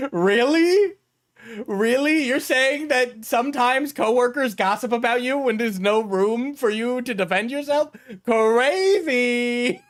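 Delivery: high, sing-song fake disbelief; sarcastic mock shock. Streamer mocking a clip about coworker gossip, sarcastic disbelief. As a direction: play dumb amazement to mock the obvious.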